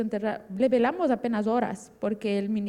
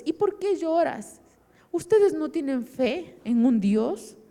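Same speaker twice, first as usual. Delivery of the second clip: drawn-out, higher-pitched insistence; emphatic reassurance; 6 semitones higher than usual. Retold or imitated. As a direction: comfort with slow, firm conviction. Uncle firmly reassures crying niece.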